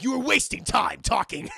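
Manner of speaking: loud scolding; stern loud rebuke. Retold or imitated. Scorpion scolding the group, loud stern. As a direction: scold them for chatting. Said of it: "Scorpion's like".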